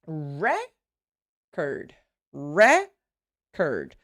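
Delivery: "record" broken as REC... ord; long stressed first syllable. Shown as a demonstration. Teacher showing syllable length, exaggerated first-syllable stress on "record". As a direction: hold REC in "record", pause, then a weak second syllable. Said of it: "look how long, how much longer".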